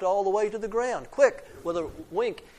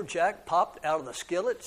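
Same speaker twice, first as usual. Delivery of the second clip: usual speaking voice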